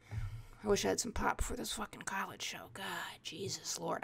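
near-whispered grumble to oneself; hushed, fading plea; muttered aside. Acted. Comic dreading a college show, muttered aside.

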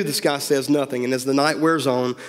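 usual speaking voice

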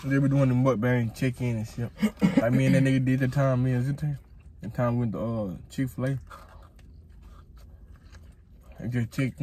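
eating between words; talking while chewing. Real, not acted. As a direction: mouth partly full of food. Seen 0: Purple-hooded speaker holds and bites food while talking through the clip.

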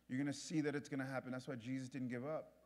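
usual speaking voice

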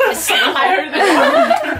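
high, drawn-out spooky call; slow high voice checking if anyone is home; mock creepy voice. Friend retells a scary moment in a mock creepy voice. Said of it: "she goes".

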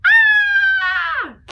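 high piercing scream; loud scream. Shown as a demonstration. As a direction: scream like seeing a crocodile. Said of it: "don't forget to scream".

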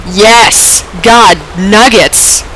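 drawn-out celebration rising to a high whoop; triumphant cheer. Real, not acted. Gamer finally lands the jump, triumphant whooping.

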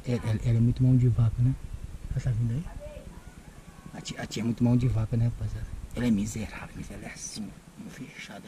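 low, soft conspiratorial aside; hushed aside. Real, not acted. Nephew secretly briefing viewers on a prank, hushed. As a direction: keep it down so she won't hear. Hiding prank setup from aunt.

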